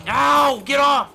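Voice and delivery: high loud yelp of alarm; startled yelling. Real, not acted. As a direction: shout it as you get hit. Attacked in game, yells.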